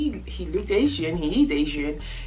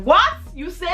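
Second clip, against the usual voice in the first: recreate the moment of shock, half laughing; shocked disbelief; 10 semitones higher than usual. Retold or imitated. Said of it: "I was like".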